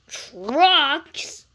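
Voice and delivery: loud, disgusted retort; cross. Acted. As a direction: scoff at the idea. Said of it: "said Gordon crossly".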